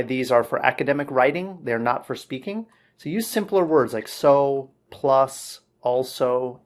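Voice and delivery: ordinary talk, nothing marked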